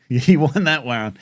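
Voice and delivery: laughing it out; conceding through a laugh. Real, not acted. Laughing as the line is repeated.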